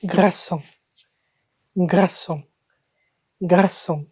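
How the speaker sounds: "garçon" with a throat R and a nasal final "on"; French pronunciation. Shown as a demonstration. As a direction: model "garçon" as gra-sonh, final N left in the nose. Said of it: "it's garçon".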